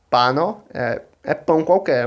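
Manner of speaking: ordinary talk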